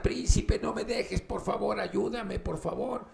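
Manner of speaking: high, pleading princess voice. Acted. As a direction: beg him not to leave you. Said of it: "y la princesa". (princesa suplicando con voz aguda)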